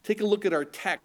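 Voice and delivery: ordinary talk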